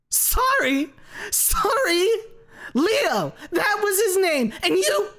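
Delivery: high, loud, mad shriek; wild scream. A narrator's reading. Basil screaming at Lucy over his dead son Leo. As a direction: scream in madness and despair. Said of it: "laughter melds with scream".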